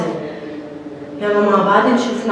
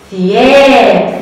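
ثياب stretched very slowly and a bit louder; slow model word; 4 dB louder, much slower than usual. Shown as a demonstration. Teacher to children, modelling ثياب very slowly. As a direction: draw out every sound of ثياب.

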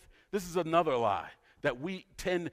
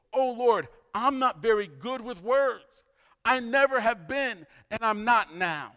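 pleading; 6 semitones higher, 4 dB louder than usual. Retold or imitated. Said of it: "Moses pleaded with the Lord".

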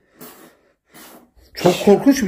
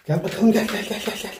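very soft, repetitive pet call; hushed little come-here; soft coaxing; 9 dB quieter than usual. Real, not acted. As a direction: coax a cat closer under your voice. Coaxing the cat over.

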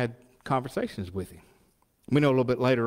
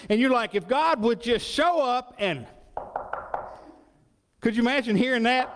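high, lively preacher's questions; preaching; 9 semitones higher than usual. Acted. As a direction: draw the congregation in. Preaching to the congregation.

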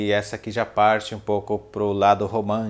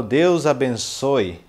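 slow emphatic phrase; 4 semitones higher, 7 dB louder, much slower than usual. Shown as a demonstration. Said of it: "Como que eu falo".

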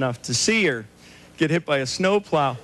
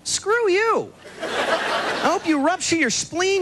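higher, slow, hammed-up outburst; mock angry retort; 10 semitones higher, slower than usual. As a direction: snap back at the idiot in mock rage. Mock retort to well-wisher.

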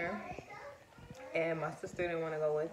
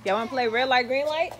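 calling out; 5 semitones higher, 10 dB louder than usual. Real, not acted. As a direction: shout the game invite across the yard. Calling kids outside.

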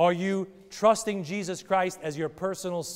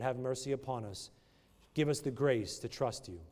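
closing prayer; 3 semitones lower than usual. Acted. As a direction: pray humbly over the congregation. Pastor closes in prayer.

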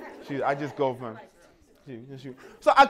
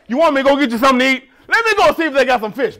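fast, higher, louder eager offer; eager rush; 5 semitones higher, 8 dB louder, much faster than usual. Retold or imitated. Bob offers fetching fish.

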